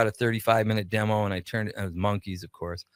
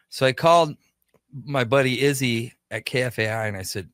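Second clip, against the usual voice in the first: ordinary delivery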